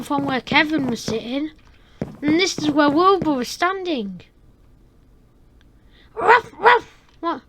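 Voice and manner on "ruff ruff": high loud yappy barks; dog barking. Acted. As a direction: bark like a dog.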